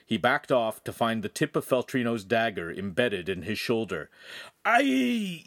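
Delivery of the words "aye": high, drawn-out pained yell; scream of pain. A narrator's reading. Ugolino stabbed in the shoulder, high pained scream. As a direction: cry out high as the blade goes in. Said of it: "he screamed".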